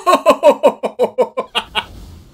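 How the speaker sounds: high, loud laugh of disbelief; real stunned laugh; disbelieving laugh. Real, not acted. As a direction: burst out laughing at the bad luck. Reacting to opponent's lucky draw.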